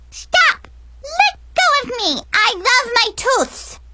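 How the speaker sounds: put-on very high, frantic kid yelling; little girl shrieking. Brianna shrieks at Mackenzie, very high. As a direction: squeal like a kid being grabbed.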